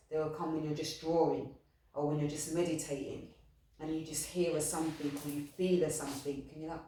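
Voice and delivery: ordinary talk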